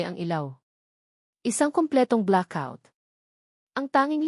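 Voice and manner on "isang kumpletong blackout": ordinary delivery, nothing marked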